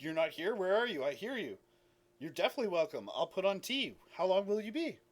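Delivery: lightly voiced puzzled host, lifting on the questions; mildly thrown, then welcoming; slightly flustered friend. Friend answers an unseen caller, slightly flustered. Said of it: "a little bit stuttered".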